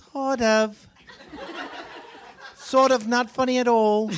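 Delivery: high-pitched, drawn-out imitation of a friend; mock verdict on a failed joke, high and slow; Nikki. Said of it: "she goes".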